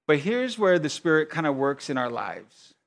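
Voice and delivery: ordinary talk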